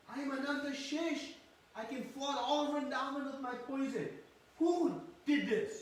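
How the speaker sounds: high, loud furious roar of an enraged brother; fiery mad. Retold or imitated. Balaram demanding who dared bind Krishna, loud furious roar. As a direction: thunder it out as if you could burn the universe. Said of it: "becomes fiery mad".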